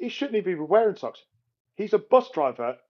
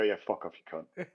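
low, quiet, offhand brush-off; grumpy dismissal; 10 semitones lower, 7 dB quieter than usual. Retold or imitated. Imagined tired driver rebuffing a friendly good morning, grumpy. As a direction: grumble it low and let it fall away.

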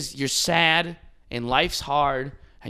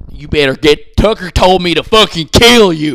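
loud laughing threat, building to a shout; 4 semitones higher, 11 dB louder than usual. Retold or imitated. Mocking a redneck attacking masks.

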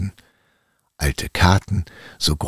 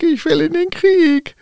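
even higher, louder tin soldier yell; toy soldier shouting; 5 dB louder than usual. Acted. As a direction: yell it as loud as you can. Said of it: "rief der Zinnsoldat so laut, wie er nur konnte".

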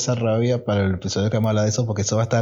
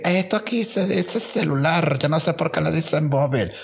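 burla; 6 semitones higher than usual. Retold or imitated. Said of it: "Llegar y que".

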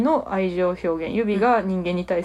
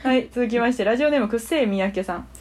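ordinary talk, nothing marked

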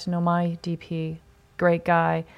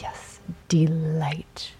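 slow whisper; 3 dB quieter, much slower than usual. Retold or imitated. Repeats the compliment, hushed.

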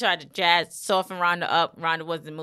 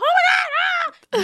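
high, drawn-out mock scream; retold screaming; slower than usual. Wrestler screams as her arm is grabbed, mock screaming. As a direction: scream out in hammed-up pain. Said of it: "Yelling, screaming".

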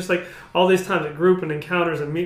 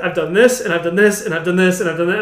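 louder, listing his sins one by one; louder retold confession; 7 dB louder than usual. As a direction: pour out the list of sins, louder. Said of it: "started listing everything".

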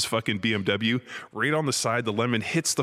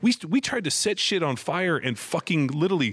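high-pitched, mock-outraged rant; retold upset rant; 6 semitones higher than usual. Homeowner ranting at timid trick-or-treaters, mock upset. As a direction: protest in a raised pitch, playing up being upset. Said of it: "I was so fucking upset".

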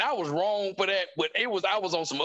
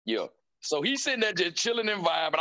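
ordinary talk

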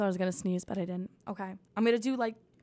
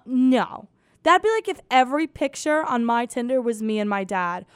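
usual speaking voice

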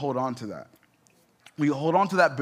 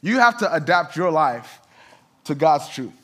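full-force preaching; 2 semitones higher, 4 dB louder than usual. Acted. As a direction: preach it out to the congregation, rising.

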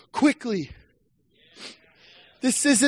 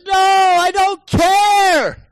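much higher, louder, drawn out very slowly; defiant shout; 9 semitones higher, 4 dB louder, much slower than usual. Acted. Preacher voicing a disciple defying the Pharisees, slow and loud. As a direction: shake off the fear of man. Heart stirring against fear.

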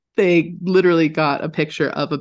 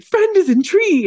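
high, slow, simple puppy voice; dog voice; 5 dB louder than usual. Retold or imitated. Storyteller voicing the dog under the tree, high and slow. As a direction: say it like a proud dumb dog.

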